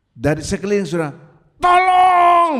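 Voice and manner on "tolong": high, drawn-out scream for help; shouted cry for help. Acted. A sudden cry heard from around the house, shouted. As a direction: yell as if alarming the neighbourhood. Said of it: "tiba-tiba terdengar teriakan".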